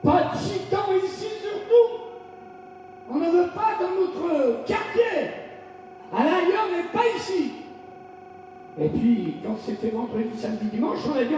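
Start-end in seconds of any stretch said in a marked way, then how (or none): 0.0-7.5 higher-pitched mock mayor refusing; a hint of an indignant official; imitating the mayor. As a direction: act out the mayor turning them away, pitched up. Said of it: "le maire il a dit".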